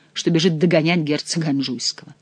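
ordinary delivery

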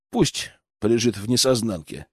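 plain reading voice

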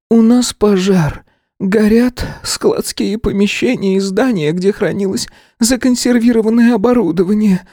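a woman broken by bad news; crushed voice. A narrator's reading. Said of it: "убитым голосом сказала директриса". (убитым голосом)

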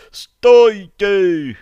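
high, very loud panicked plea; full-voiced yell from across the hall. A narrator's reading. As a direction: scream for them to stop. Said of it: "заорали из зала".

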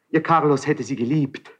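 usual speaking voice

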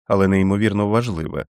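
usual speaking voice

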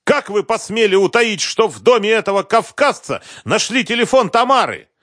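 high, loud furious demand; raging. Acted. Husband raging at investigator, furious shout. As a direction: rage down the phone. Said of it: "продолжал бушевать Концевич".

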